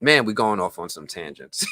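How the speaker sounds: ordinary delivery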